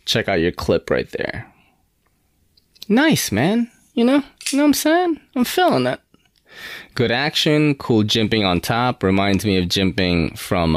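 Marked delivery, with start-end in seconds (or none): 2.9-6.0 hyped high praise. Real, not acted. Knife reviewer admiring the clip, high and hyped. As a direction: pitch jumps way up, gushing over the knife.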